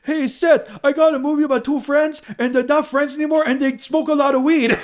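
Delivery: high, loud goofy-guy voice; mimicking Evan Goldberg. Roast joke mocking Seth's writing partner, high goofy voice. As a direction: pitch way up for a dopey pitch meeting. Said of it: "mimicking what Evan Goldberg must be like".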